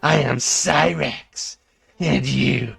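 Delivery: menacing slow movie-villain read; cold, slow threat over music; dramatic villain monologue. Acted.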